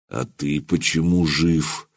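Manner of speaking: low, soft, slow and icy; cold steel voice. A narrator's reading. The voivode coldly questions the serf, icy and slow. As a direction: let each word cut like cold steel. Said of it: "будто сталь холодная в мясо входит".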